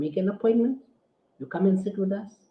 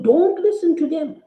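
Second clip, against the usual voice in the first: mocking opponent's quote; 5 semitones higher than usual. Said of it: "They tell you".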